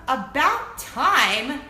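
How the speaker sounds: about time drawn out very slowly; emphatic. Real, not acted. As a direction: savor every word. Approval of diversity.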